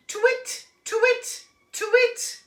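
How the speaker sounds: high, loud repeated twit; owl calling again. Acted. Olive tries again, calling a high twit into the night. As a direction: keep calling like a hopeful owl. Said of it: "called Olive, trying again".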